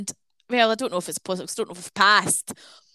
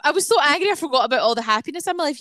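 excited; 7 semitones higher, 4 dB louder, faster than usual. Real, not acted. Sudden happy remembering.

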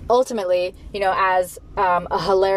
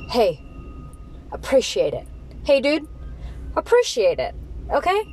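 higher, exasperated mock lecture; life scolding you; 5 semitones higher than usual. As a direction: scold like a fed-up parent, voice climbing. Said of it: "and life's like".

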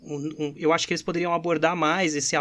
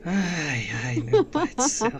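catching breath after laughing; slower than usual. Real, not acted. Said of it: "Deixa eu respirar aqui". (sem fôlego de tanto rir)

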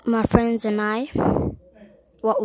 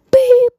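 very high loud beep; censor bleep; 9 dB louder than usual. Retold or imitated.

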